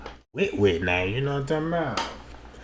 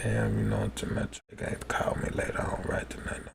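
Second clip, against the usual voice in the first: hushed whisper; 9 semitones lower, 16 dB quieter, faster than usual. Retold or imitated. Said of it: "her cousin them coming to me like".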